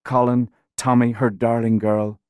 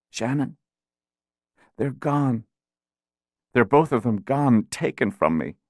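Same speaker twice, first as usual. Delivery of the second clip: grieving; 3 semitones higher than usual. Acted. Dying mother grieving, weak voice.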